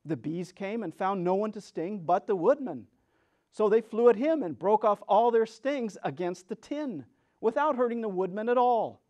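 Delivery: usual speaking voice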